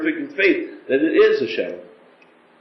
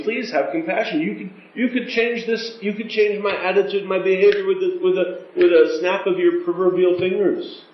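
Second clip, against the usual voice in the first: higher, urgent begging prayer; a fervent plea for compassion; pleading with Hashem; 6 semitones higher than usual. Shown as a demonstration. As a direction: implore God to change your heart. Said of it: "asking him".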